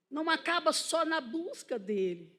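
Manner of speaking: ordinary talk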